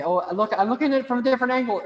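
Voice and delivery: high, loud, rushed mock amazement; mock excitement. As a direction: rattle it off like an over-eager repeat customer. Mocks endlessly going back for more.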